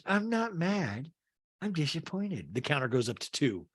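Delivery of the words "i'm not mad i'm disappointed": slow, drawn-out mock denial; Meredith. Meredith denies being mad, slow imitation. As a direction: stretch it out, higher then softer. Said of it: "And Meredith goes".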